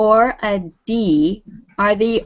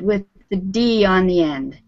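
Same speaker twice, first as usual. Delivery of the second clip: usual speaking voice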